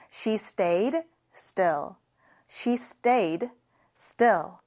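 slow read with a small break before still; slow model sentence. Shown as a demonstration. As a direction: model it slowly, then split. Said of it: "같이 해볼까요". (천천히 끊어 읽기)

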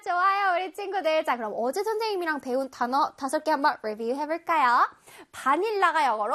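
ordinary talk

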